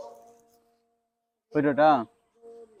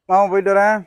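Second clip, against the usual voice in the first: louder, higher farewell call; calling out goodbye; 4 semitones higher, 7 dB louder than usual. Real, not acted. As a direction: call your goodbye across to them. Calling out goodbye before leaving. (சத்தமா விடைபெறுதல்)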